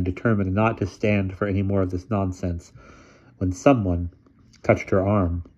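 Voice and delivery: plain reading voice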